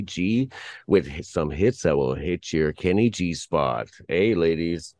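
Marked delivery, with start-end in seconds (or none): none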